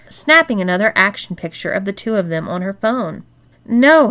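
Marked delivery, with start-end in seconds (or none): 3.6-4.1 louder, higher groan of objection; groaned protest. A narrator's reading. Man to the two women in his bed, groaned protest. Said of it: "he groaned".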